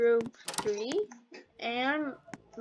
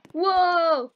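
loud falling whoa; loud surprised whoa at candy; delighted whoa; 3 semitones higher, 8 dB louder than usual. Real, not acted. Spots the candy.

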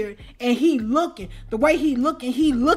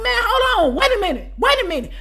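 higher-pitched, pushy retold demand; imitating insistent man; 9 semitones higher, 4 dB louder than usual. Man chasing a woman who said no, pushy and insistent. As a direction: act out a man pressing her to stop. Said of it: "he's getting more aggressive".

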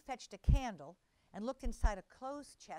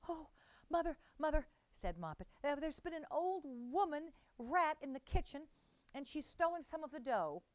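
lightly raised, frightened little kitten voice; a hint of a frightened kitten; 6 semitones higher than usual. A narrator's reading. Moppet runs to her mother, lightly frightened kitten. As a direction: gasp it out like a scared kitten. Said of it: "in a terrible fright".

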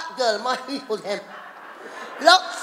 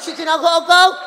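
firm farewell; 4 semitones higher, 7 dB louder than usual. Acted.

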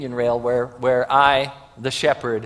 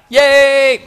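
excited cheer; 10 semitones higher, 13 dB louder than usual. Real, not acted. Cheering a child who found a sheep.

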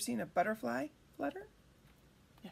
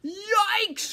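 high, loud mock-terrified yelp; shriek; 10 semitones higher, 11 dB louder than usual. A narrator's reading. Fox hit on the head, high startled shriek. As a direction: shriek it like a cartoon villain. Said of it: "shrieked Foxy Loxy".

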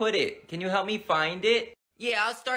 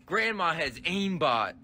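ordinary talk

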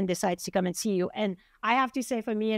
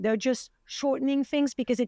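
ordinary talk, nothing marked

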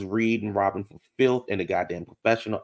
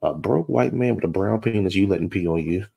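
ordinary talk, nothing marked

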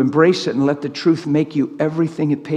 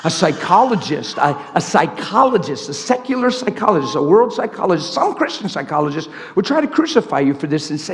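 ordinary talk

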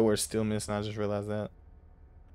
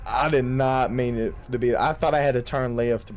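ordinary talk

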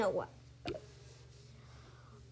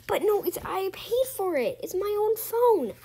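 upset protest; 6 semitones higher, 4 dB louder than usual. Acted. Kid protests to babysitter.

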